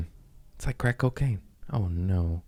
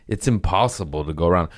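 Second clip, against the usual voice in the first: ordinary talk, nothing marked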